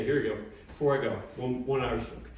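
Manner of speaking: ordinary delivery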